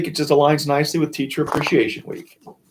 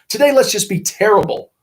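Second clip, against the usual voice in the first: put-on mischievous kids; 8 semitones higher, 5 dB louder than usual.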